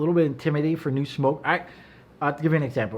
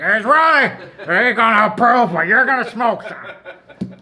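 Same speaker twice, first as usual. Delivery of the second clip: higher, louder mocking voice of the lounge guys; mocking lounge regulars; 7 semitones higher, 7 dB louder, slower than usual. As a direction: ham up the snobby regulars, ending slower with a laugh.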